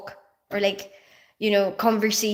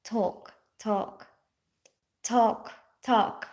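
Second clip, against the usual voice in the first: Filipino accent; 2 semitones lower, 8 dB quieter, much slower than usual. Shown as a demonstration. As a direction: TALK tried out in a Filipino accent, softly. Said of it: "my Filipino accent is like when I say talk".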